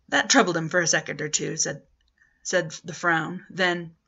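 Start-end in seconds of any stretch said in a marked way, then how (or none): none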